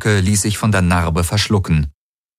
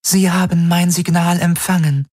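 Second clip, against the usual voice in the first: high, even otherworldly voice; alien helix voice; 10 semitones higher than usual. Acted. Alien helix reports its signal arrived, otherworldly voice.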